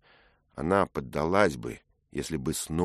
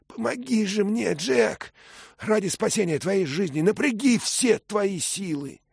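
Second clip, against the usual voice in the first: much higher, louder, straining plea; urgent young Dick; 5 dB louder than usual. Acted. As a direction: urge her with all force.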